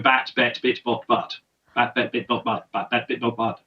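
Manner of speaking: "bat, bet, bit, bot, but" said as separate crisp syllables; each vowel in "bat, bet, bit, bot, but" shaped clearly between b and t; vowel drill. Shown as a demonstration. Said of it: "So you go".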